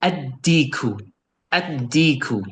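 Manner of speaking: "Addiku" with a held d and no L; L silent, D doubled. Shown as a demonstration. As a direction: jump from the a straight onto the d in "Addiku". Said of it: "It's like I'm erasing this".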